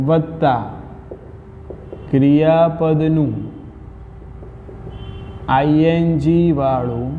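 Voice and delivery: word by word, long pauses between pieces; slow dictation. Shown as a demonstration. Teacher dictates grammar rule to class, slow dictation. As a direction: dictate slowly, leaving time to write.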